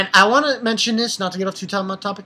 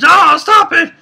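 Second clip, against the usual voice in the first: loud, high mock outburst; pissed-off retort; 10 semitones higher, 10 dB louder, slower than usual. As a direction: yell it in mock annoyance at a nickname. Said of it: "so pissed off at Momiji".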